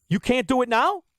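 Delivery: ordinary delivery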